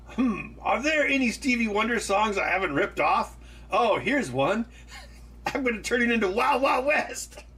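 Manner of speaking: a smug plotting voice; mock scheming musician. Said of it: "Look at Wild Wild West".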